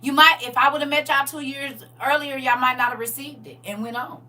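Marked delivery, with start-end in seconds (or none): none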